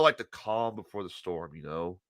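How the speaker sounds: low, hushed storyteller; hushed suspense. Acted. Storyteller sets up the calm before the food fight, hushed. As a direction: drop almost to a whisper. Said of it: "It's quiet".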